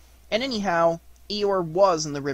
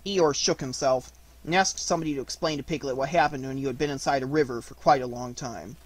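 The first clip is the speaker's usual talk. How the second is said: usual speaking voice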